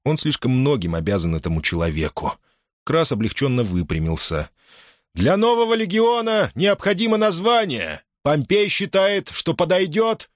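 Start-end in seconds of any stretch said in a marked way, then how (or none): 5.2-10.3 voiced commander. A narrator's reading. Crassus asks for name.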